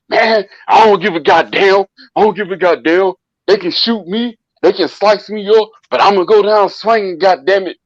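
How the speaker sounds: put-on drunk defiant cousin. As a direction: play him swaggering, ready to fight.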